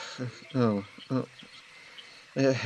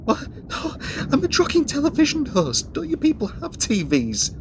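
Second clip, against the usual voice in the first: much higher, louder wailing protest; exasperated cry; 5 dB louder than usual. Acted. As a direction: shriek it in total disbelief. Exasperated outburst at soldier.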